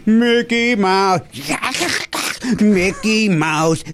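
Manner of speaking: high, slow squawking duck impression; Carol Channing duck voice. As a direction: do a celebrity imitating a duck. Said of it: "That's me doing Carol Channing, doing an impression of a duck".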